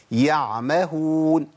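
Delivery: "يعمهون" very slowly, ع clearly sounded before the م; audible resting ع. Shown as a demonstration. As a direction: stretch "يعمهون", letting the ع be heard. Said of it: "لازم أبين صوتها".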